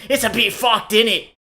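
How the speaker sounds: loud, mock British accent. Giving the co-host an example of a thick British accent. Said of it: "a British accent, for example".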